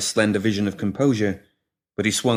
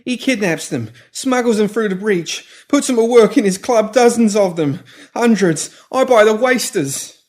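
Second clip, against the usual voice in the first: much higher, louder frantic begging; panicked pleading; 13 semitones higher, 6 dB louder than usual. Acted. Pinky pleading with the woman holding shears, panicked. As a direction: beg for your life, backing away.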